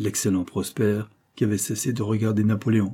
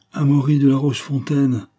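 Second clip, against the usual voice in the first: louder, higher impatient cry; impatient exclaim; 4 semitones higher, 6 dB louder than usual. Acted. Detective impatiently exclaims the visitor's name. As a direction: blurt the name out, annoyed. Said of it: "s'écria Coquentin avec impatience".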